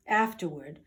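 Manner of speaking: ordinary delivery, nothing marked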